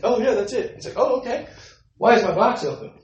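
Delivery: high, loud, startled "oh, okay"; suddenly catching on and getting indignant; retold surprise. Tenant spotting his opened box, loud and startled.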